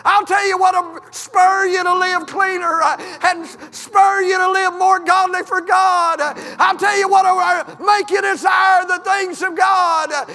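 high, loud preaching that builds to a shout; preacher calls the congregation back to the cross, building to full shout; preaching at full force. Acted.

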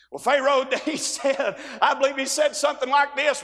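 usual speaking voice

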